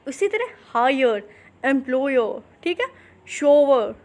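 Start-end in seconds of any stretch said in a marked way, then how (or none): none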